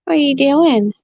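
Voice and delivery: high teasing pet voice; playful high pet chatter. Real, not acted. Talking to bird.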